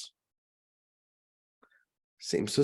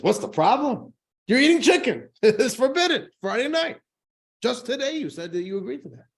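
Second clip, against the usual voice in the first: higher, louder indignant disbelief; the priest protesting to Moshe, higher and louder; outraged priest; 10 semitones higher, 4 dB louder than usual. Acted. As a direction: sputter at the nerve of it, high and loud.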